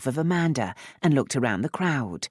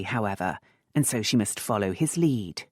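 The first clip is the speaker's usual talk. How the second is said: plain reading voice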